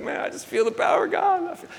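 high-pitched imitation of a man crying; retold tearful voice. Man overcome during prayer, retold tearful voice. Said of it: "he starts crying".

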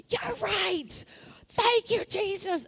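high-pitched mock shout, not loud; held-back yell. Answering the Holy Spirit with praise, restrained high yell. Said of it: "I won't yell as loud as I did".

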